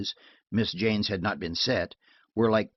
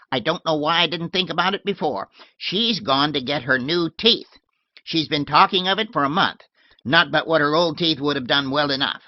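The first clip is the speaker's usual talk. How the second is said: put-on louder, higher tart old woman; old lady shooing her maid off, tart and loud; shrill old lady; 6 semitones higher, 6 dB louder than usual. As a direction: bark it like a cranky old lady. Miss Letitia voiced.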